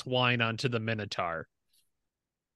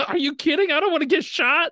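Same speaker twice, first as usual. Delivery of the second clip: high squeaky fast laughing voice; tiny laughing squeak; tiny Minotaur laughing; 14 semitones higher, 4 dB louder, faster than usual. Acted.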